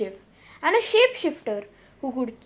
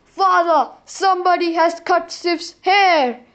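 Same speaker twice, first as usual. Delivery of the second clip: louder, urgent report; stormy Thor; 4 semitones higher, 5 dB louder than usual. Acted. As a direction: burst in on his father. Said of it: "Thor stormed up".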